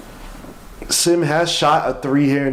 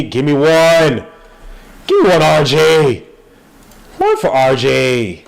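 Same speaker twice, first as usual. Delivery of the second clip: loud, rising hype for the shot; excited call; 3 semitones higher, 5 dB louder than usual. Real, not acted. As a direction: shout him on to make the three. Hyping a three-point shot.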